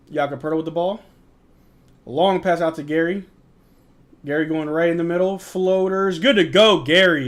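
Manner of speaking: lively mock broadcaster call; amateur sportscaster; play-by-play. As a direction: call the action like a TV commentator. Calls the game play-by-play.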